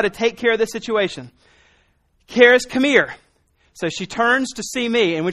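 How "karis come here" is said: louder, higher call to come here; firm call. Retold or imitated. Dad urgently calls daughter before the cow scares her, raised voice. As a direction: summon her firmly across the room.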